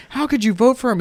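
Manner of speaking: high-pitched incredulous question; mock dismay. People questioning the guy's vote, dismayed. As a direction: imitate appalled people challenging a voter. People challenge Trump voter, acted.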